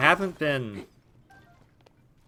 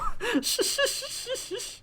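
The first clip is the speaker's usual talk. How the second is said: squeaky laugh at herself; a sudden high-pitched giggle; embarrassed squeal of laughter. Real, not acted. Laugh at own slip.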